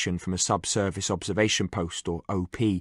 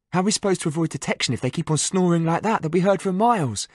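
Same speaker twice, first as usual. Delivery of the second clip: mild exasperation; 8 semitones higher than usual. Acted. Liam complaining about snoring.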